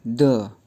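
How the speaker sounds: द said lightly and short, toneless; barely touch द, quick and unstressed; light neutral tone. Shown as a demonstration. Teacher showing the light neutral tone on द. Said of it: "इसको हम lightly pronounce करेंगे".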